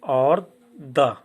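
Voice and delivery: teacher sounding out द. Shown as a demonstration. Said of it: "D का उच्चारण होता है ड".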